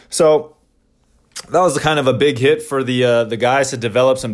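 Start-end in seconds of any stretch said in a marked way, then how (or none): none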